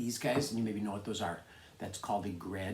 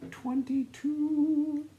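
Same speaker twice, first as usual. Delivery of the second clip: much higher and slow, a playful reveal; high, drawn-out reveal; much slower than usual. Shown as a demonstration. Teacher revealing the flipped reading to class, high and slow. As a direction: sing it out like a surprise answer.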